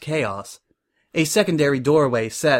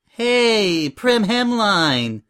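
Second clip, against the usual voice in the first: high, drawn-out, ingratiating hello; butter up the judge with a big toothy smile; gushing greeting; 8 semitones higher, 4 dB louder, much slower than usual. Acted.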